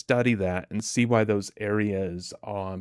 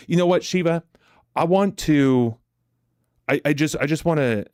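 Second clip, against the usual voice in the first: calling out to him, bright and louder; excited shout-out; 6 semitones higher, 5 dB louder than usual. Real, not acted. Excited shout-out to young viewer.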